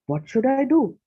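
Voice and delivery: high, pleading cry of helplessness; a mother at a loss, near tears; weeping despair. A narrator's reading. Mother asks her maids for help, in tearful despair. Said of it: "Suniti wept".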